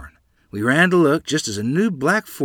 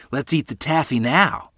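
mildly pleading, raised pitch; begging; 6 semitones higher, 5 dB louder than usual. A narrator's reading. Children beg for the saved taffy, pleading lightly. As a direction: coax for a treat, a little higher. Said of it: "they begged".

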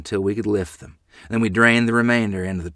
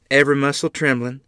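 ordinary delivery, nothing marked

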